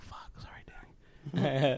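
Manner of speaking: hushed, whispered curse; whispered apology. Retold or imitated. Student grader realizing it was Danny's page, whispered. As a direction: whisper it under your breath.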